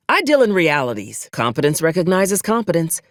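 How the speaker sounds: ordinary delivery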